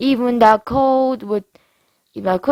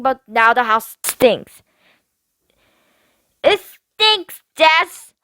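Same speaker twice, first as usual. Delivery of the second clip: higher, bratty whining into the phone; spoiled girl ranting; 7 semitones higher, 4 dB louder, slower than usual. Acted. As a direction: rant like a mean girl on the phone. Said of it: "screaming around and fro".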